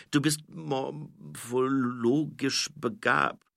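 slow, halting delivery; stumbling over word. A narrator's reading. Nobby choosing a word for Angua, haltingly slow. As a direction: stumble through the long word bit by bit. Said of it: "Nobby stolperte über das Wort".

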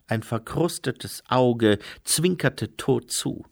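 ordinary delivery

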